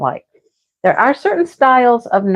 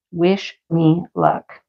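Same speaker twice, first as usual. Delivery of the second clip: slow wish me luck; 3 semitones lower, 4 dB quieter, much slower than usual. Real, not acted.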